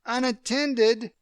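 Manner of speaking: high, slow shrieked echo of the word; screeched. Acted. Amy screeching at John over leaving Emily. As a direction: screech it back in disbelief. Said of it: "Amy screeched".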